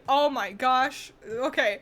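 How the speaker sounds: usual speaking voice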